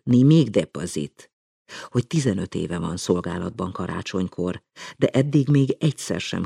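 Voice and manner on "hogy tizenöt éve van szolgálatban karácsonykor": usual speaking voice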